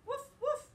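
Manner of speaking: high, loud woof woof; excited dog bark. Acted. Teacher barking as Biscuit for the class, loud and eager. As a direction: bark eagerly like a puppy at play.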